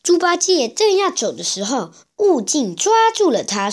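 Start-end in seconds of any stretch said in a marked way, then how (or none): none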